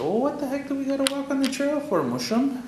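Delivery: put-on high, quick kid's voice whining at grandpa; cheeky boy asking. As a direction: play the young boy pestering his grandfather. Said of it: "I said".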